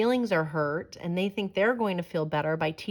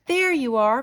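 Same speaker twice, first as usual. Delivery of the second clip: higher, louder mother calling out; relieved cry; 7 semitones higher, 5 dB louder than usual. Acted. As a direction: call out to a returning daughter. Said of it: "cried Mama Hyena".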